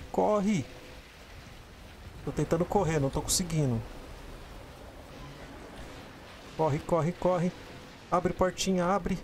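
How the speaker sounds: frantic gamer fleeing, shouting run; being chased. Real, not acted. Fleeing a monster in the game. (correndo em pânico)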